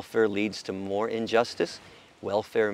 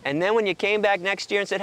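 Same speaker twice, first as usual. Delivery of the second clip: ordinary talk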